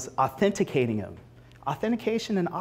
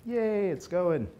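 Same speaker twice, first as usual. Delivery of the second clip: mock cheer; 4 semitones higher, 4 dB quieter, slower than usual. Mock cheer as demo works.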